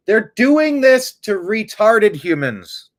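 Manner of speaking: loud, slow, high outburst; outraged. Real, not acted. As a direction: slam it down in disgust. Outraged at the victims.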